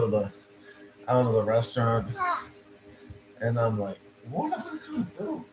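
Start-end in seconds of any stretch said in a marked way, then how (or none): 4.3-5.4 much higher but softer; lost and incredulous; bewildered question. Retold or imitated. Panicking about friend.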